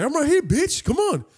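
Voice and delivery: high-pitched, goading fight talk; mock-furious confrontation, high; acted challenge. Acts out locker room quarrel.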